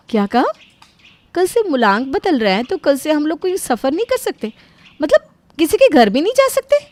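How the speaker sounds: high, projected, put-on surprise; pretend-chat on the phone so others overhear; loud fake phone call. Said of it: "झूठ मूठ phone पर ज़ोर-ज़ोर से बातें करने लगती है". (फ़ोन पर ज़ोर-ज़ोर से बनावटी बातचीत)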